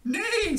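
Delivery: high, loud, falling whinny; imitated high-pitched neigh; horse neigh. A narrator's reading. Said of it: "said the horse".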